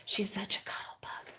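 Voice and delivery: soft unvoiced whisper. Real, not acted. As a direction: whisper so the puppy isn't disturbed. Whispering about the puppy.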